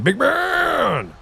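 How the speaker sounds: Big man! drawn out high and long, falling at the end; booming hype call. Real, not acted. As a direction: belt it out like hyping up a friend. Repeats greeting to raider, booming.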